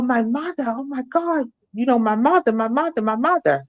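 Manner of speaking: high, anguished repeated cry, imitated; retold frantic screaming. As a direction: son hollering for his mother, replayed. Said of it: "hearing him screaming, hollering".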